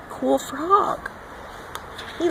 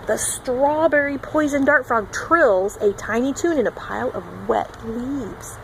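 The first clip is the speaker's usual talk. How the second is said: usual speaking voice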